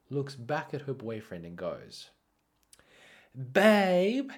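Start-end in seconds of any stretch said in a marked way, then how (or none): 3.3-4.3 high, loud imitated call; as loud as possible. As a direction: holler out to your boyfriend across a distance. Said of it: "as loudly as she possibly can".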